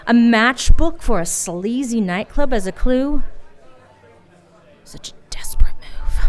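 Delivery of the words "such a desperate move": whispered to herself; self-scolding whisper. Acted. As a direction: hiss the thought under her breath.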